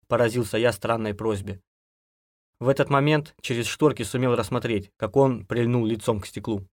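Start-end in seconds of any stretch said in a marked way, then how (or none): none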